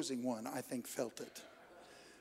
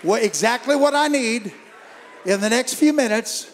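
loud, high call to the crowd; full-force preaching; 6 semitones higher, 13 dB louder than usual. Real, not acted. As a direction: lead them in a shouted declaration.

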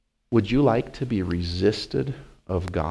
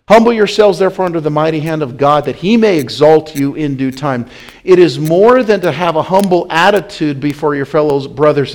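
"Humble yourself" cried out loud and high; loud preacher's proclamation; preached verse; 5 semitones higher, 9 dB louder than usual. Acted. Preacher reads verse loudly.

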